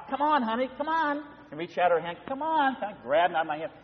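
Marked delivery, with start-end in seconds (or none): 0.1-1.2 higher, softer coaxing call to a lagging husband; a wife smiling back and coaxing her husband along. Retold or imitated. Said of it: "look behind with a smile". 2.3-2.8 higher, softer coaxing call to a lagging husband; a wife smiling back and coaxing her husband along. Retold or imitated. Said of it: "look behind with a smile".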